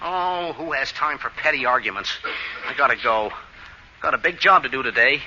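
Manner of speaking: low, soft talking to himself; resigned aside. Acted. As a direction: drop low and shrug off the quarrel. Muttering to himself after rejection.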